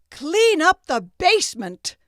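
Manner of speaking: high, loud bossy order; commanding apprentice. Acted. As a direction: bark the chore like a proud young master.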